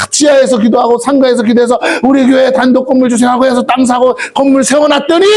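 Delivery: high, crying exhortation from the pulpit; impassioned preaching. Real, not acted. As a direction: cry out in indignant reproach to the church. Preaching at full force. (높은 음으로 부르짖듯 열띤 설교)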